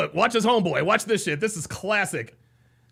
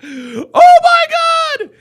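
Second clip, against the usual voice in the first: very high, loud, drawn-out wail; hilarious disbelief; 13 dB louder, much slower than usual. Real, not acted. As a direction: wail it slowly, overcome with laughter. Cracking up at video clip.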